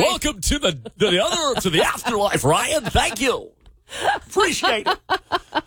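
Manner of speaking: high-pitched mock radio host; exaggerated on-air announcer voice; radio guy. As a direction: ham it up like a DJ welcoming listeners. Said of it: "we talk like this all".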